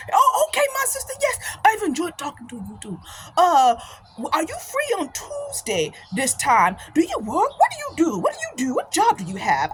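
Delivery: eager imitated African accent, leaping high; African-accented chatty interrogation; African brother's accent. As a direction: mimic an African brother pressing with questions. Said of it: "this brother from Africa".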